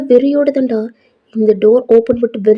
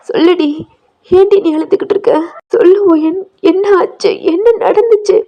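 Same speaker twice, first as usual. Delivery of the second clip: anxious pleading; 5 semitones higher, 4 dB louder than usual. Acted. Mother desperately asks crying daughter.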